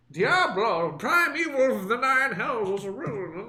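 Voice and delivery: Deckard Cain voice. Acted. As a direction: intone it like an ancient storyteller. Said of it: "the Deckard Cain old man".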